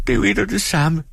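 meget høj, såret pigestemme; såret bebrejdelse. Acted. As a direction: kast bebrejdelsen ud grædefærdigt. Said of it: "udbrød Dora". (Dora anklager sin mand, højt og såret)

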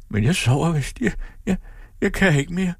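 very high, faltering voice; failing plaintively. A narrator's reading. Sick woman sinking into sleep, plaintive and failing. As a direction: falter as if giving up.

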